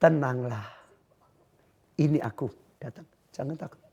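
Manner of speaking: soft, low and hushed, fading to a whisper; calm reassurance. Acted. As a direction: soothe the frightened ones gently. God speaks to the listeners. (suara lembut menenangkan)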